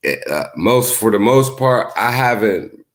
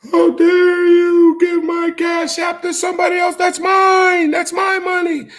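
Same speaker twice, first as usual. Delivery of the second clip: high, loud indignant yelling, hammed up; mock furious shout; 5 dB louder than usual. Imitating a YouTuber yelling at Tammy over cash app, hammed-up shout. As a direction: throw a tantrum over your money.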